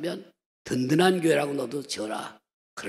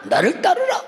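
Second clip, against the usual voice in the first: grand command; 12 semitones higher, 4 dB louder than usual. Retold or imitated. Pastor's grand reply to wife.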